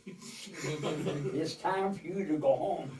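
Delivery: slow, laughing through the last words; laughing punchline. Real, not acted. To the listener, delivering the punchline while laughing. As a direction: let the laugh spill into the punchline.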